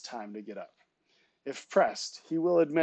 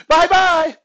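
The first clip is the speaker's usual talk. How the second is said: very high, loud squeal of a shout; toddler's yell; 15 dB louder than usual. A narrator's reading. Baby alarm alerting mother to an escape, high yell. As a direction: yell it like a tiny alarm going off. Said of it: "she shouts it".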